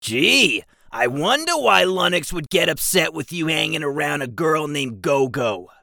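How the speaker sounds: high, dry goblin sarcasm; sarcastic goblin. Acted. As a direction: drag out Go-Go with a sneer.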